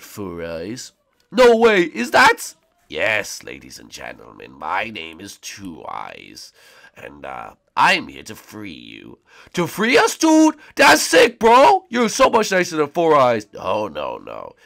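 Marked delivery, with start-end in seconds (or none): 1.3-2.5 high, loud amazed fan; excited high voice. Acted. Crowd voice. 2.9-9.2 low, slow villain voice; dark lord. Acted. Villain announces himself. 9.5-13.5 high, loud amazed fan; excited high voice. Acted. Crowd voice.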